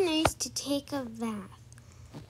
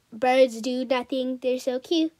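ordinary talk, nothing marked

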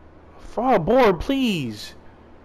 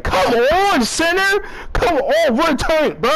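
much higher and louder, urgent; frustrated yell; 12 semitones higher, 4 dB louder, slower than usual. Real, not acted. Gamer yelling at his center to pass, urgent yell. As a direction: bark it at the center to move. Shouting at center mid game.

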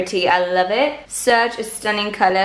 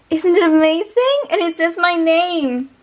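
excited gushing; 6 semitones higher than usual. Real, not acted.

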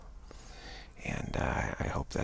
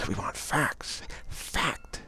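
slow, deliberate mock proclamation; mock pompous officials; much slower than usual. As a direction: spell it out like self-important officials.

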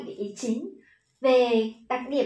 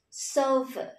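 sofa stressed on "so", falling to a short "ơ"; first-syllable stress. Shown as a demonstration. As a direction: hit the start of sofa, drop the tail. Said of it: "Âm tiết thứ nhất đúng không nào?". (sofa nhấn âm tiết đầu)

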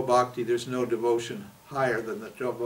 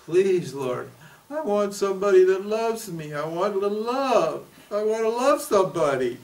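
higher, pleading voice of someone begging God for love; mock lovesick prayer; 6 semitones higher than usual. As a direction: plead like a lonely person at prayer. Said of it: "How many times have you prayed".

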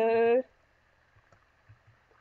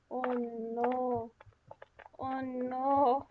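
normal talking voice

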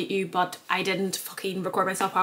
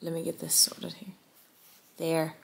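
muttered aside; 2 semitones lower, 7 dB quieter than usual. Real, not acted. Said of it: "fiddling with camera".

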